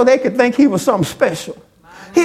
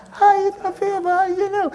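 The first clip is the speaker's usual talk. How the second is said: higher, softer throwaway question; wrong version, lightly mocked; 9 semitones higher, 4 dB quieter than usual. Shown as a demonstration. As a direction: toss it off half-heartedly, as he did not say it. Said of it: "He didn't say".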